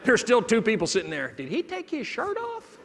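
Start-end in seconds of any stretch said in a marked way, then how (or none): 1.4-2.8 high, slow, incredulous mock voice of a bystander; imitating a shocked onlooker. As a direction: squeak it out as a scandalised passenger. Audience member's imagined question.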